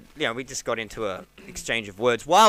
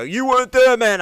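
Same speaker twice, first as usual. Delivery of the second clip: much higher, louder protest; indignant outburst; 11 semitones higher, 11 dB louder than usual. Retold or imitated. Comic imagining snapping at the MC, loud and indignant. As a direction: fire it back, offended. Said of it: "I didn't wanna go".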